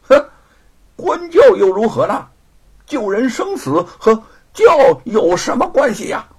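loud, high, defiant retort; bold, unimpressed scoff; scornful dismissal. A narrator's reading. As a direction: brush it off at full voice. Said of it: "姚老大不以为然".

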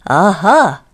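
a loud regal "Aha"; king's voice. A narrator's reading. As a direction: speak as the pleased king. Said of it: "said the king".

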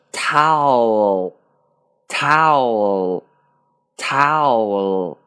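very slow "towel", long ow gliding into the L; fully blended pronunciation. Shown as a demonstration. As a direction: stretch each "towel" for learners to hear every sound. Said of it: "那连起来读呢". (慢速拉长，完整连读towel)

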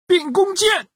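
high shouted command; monster's shout. A narrator's reading. 公鸡怪对孙悟空大喊，高声吼叫. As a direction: rooster monster yells out his spell. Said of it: "公鸡怪大喊一声".

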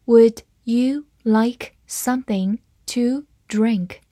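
slow, careful read-aloud. Shown as a demonstration. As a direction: read it slowly for the class. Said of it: "好，慢慢来读". (慢速朗读)

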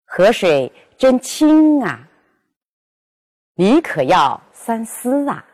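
careful, expressive reading of each example sentence; exemplary reading with the particle clearly shaped; model reading. Shown as a demonstration. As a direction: read each sentence the way a model speaker would. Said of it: "比如".

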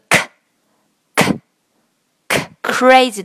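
very slow k，k，k before crazy; crisp repeated k, drawn out; slow k demo. Shown as a demonstration. Teacher drills k to compare with g. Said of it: "gonna和我们刚刚说的".

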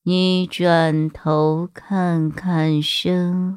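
low, slow eerie whisper; creepy outro voice. Acted. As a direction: tease a scare slowly. Spooky outro over music.

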